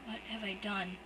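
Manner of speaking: soft, stunned; hollow, to himself; quiet. Acted. Shocked brother to himself after the attack, quiet and stunned. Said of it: "he says quietly".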